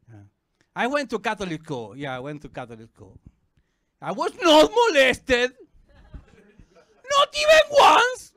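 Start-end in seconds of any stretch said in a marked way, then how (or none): 4.0-8.3 slow, high shouted denial rising to a shriek; over-the-top protest. Acted. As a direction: protest far too much, shrieking.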